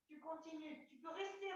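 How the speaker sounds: ordinary talk, nothing marked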